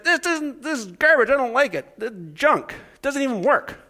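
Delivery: angry Newfie complaint. Retold or imitated. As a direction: huff back at the store like a cheated customer.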